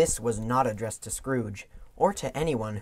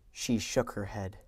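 plain reading voice

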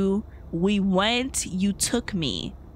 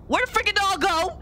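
anxious, higher, searching; scared horror game streamer; 9 semitones higher than usual. Real, not acted. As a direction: nervously call out for the doll. Searching for the scary doll.

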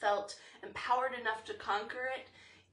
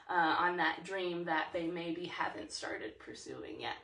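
ordinary talk

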